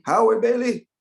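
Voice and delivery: high, loud startled question; reliving hearing his own name called out; stunned, hearing his name; shocked disbelief. Retold or imitated. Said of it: "I was shocked".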